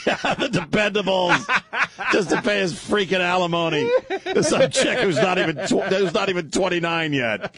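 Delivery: high-pitched, helpless laughing delivery; talking through laughter. Real, not acted. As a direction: crack up while getting the words out. Cracking up at own joke.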